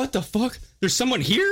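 high, startled mock voice; high-pitched mock bewilderment; startled bum. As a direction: act shocked someone found you. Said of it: "He was like".

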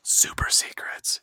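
hushed secret whisper. Pretend. Cohost teasing Patreon secrets in a whisper. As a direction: whisper it like a conspiracy. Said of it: "whispering about Patreon secrets".

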